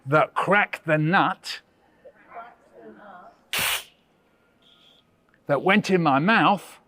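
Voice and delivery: slow, deliberate poem line; slow poem recitation. Shown as a demonstration. Teacher reciting a poem for children to repeat. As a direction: recite slowly and clearly for echo. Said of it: "I'll say".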